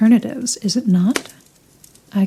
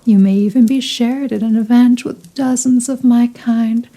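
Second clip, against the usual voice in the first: normal talking voice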